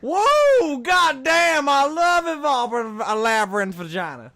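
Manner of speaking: put-on whooping drunk Southern guy, loud and high; southern drunk accent. Card reader hams a drunk Southern guy: loud Southern holler. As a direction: holler it like a drunk Southern good ol' boy. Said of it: "drunk fucking Southern guy".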